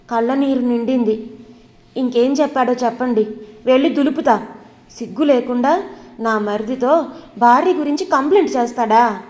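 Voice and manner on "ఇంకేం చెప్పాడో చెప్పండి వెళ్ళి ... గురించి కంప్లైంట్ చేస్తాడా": usual speaking voice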